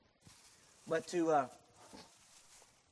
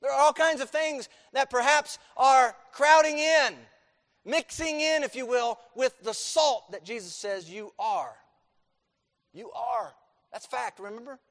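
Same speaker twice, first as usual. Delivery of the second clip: loud, high preaching, hammering each phrase; full-force preaching; 8 semitones higher, 9 dB louder than usual. Acted. Pastor drives the point home to his church, loud full-force preaching. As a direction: preach it hard to the congregation. Preaching to congregation, rising intensity.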